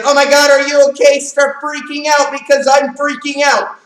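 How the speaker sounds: high, loud panicked overreaction; what not to do, hammed-up panic; mock panic. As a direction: freak out on purpose to show what not to do. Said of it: "Don't be like".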